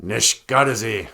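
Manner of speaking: ordinary delivery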